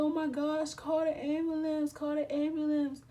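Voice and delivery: high, loud cry for help; retold panicked cry. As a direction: shout for emergency help, reenacting panic. Acting out begging for an ambulance.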